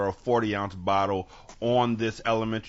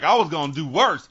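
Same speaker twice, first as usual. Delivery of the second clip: put-on boasting kid; 6 semitones higher, 7 dB louder than usual. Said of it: "but they were like".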